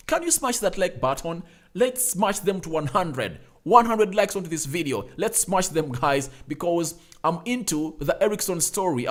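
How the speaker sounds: ordinary delivery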